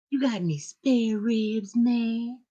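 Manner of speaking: high, drawn-out movie beggar voice; desperate begging. Retold or imitated. Mimicking a movie character pleading for spare ribs, desperate begging. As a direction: stretch it out pleadingly, pitched well up. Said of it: "gotta sound like that".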